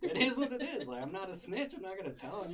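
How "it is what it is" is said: laughs the words out high; chuckling through a shrug; laughing. Real, not acted. Laughing as he tells his friends about it. Laughing while telling story.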